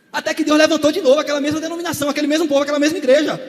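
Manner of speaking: loud preaching building to a shout; fiery preaching. Acted. Preacher stirring the congregation, building to a shout. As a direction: preach it, rising to a cry.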